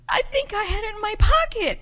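put-on high, whiny little-girl wail; mock crying. Tearful girl explaining to her host, high imitated whimper. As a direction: imitate a child close to tears. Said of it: "and she started crying".

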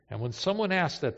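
ordinary delivery

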